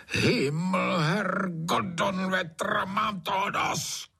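high, strained curse building to a slow last phrase; exasperated high-pitched outburst, words stretched out; furious roar. A narrator's reading. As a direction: roar like a master kept from sleep. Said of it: "zařval Trautenberg".

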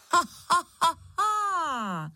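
very high, wailing evil laugh; howling witch cackle. A narrator's reading. Witch howling right beside Mikeš, high cackle. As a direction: cackle high, stretching the last into a howl. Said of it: "zaskučelo těsně vedle něho".

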